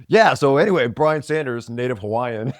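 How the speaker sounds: teasing a friend with a big intro; mock host intro. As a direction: announce him like a hammed-up emcee. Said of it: "drive you bananas when I'm like".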